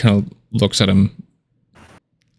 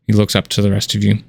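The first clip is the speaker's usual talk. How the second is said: normal talking voice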